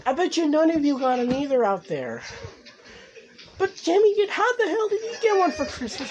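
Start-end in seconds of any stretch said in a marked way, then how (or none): 3.6-6.1 high, exasperated demand; real jealous indignation; indignant outburst. Real, not acted. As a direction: voice jumps up in disbelief. Jealous that Jimmy got one.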